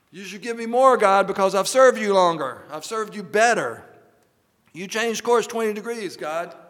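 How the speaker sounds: hammed-up entitled complaint to God; mock demanding believer. Believer bosses God around, hammed-up loud demand.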